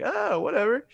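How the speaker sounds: high-pitched, offhand shrug of a quote; mock nonchalance. Comparing self to a brother who did the full thing, mock nonchalant. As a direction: imitate your own careless younger self. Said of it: "versus me just kinda be like".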